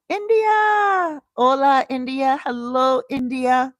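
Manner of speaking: loud, high, drawn-out cheer of greeting; real high-pitched, thrilled call-out; excited shout-out. Real, not acted. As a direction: holler hello to a whole country of fans. Calling out greetings to viewers in India.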